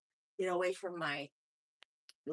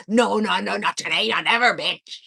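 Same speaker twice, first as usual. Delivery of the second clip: mock defiant; 9 dB louder than usual. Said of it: "I'm like".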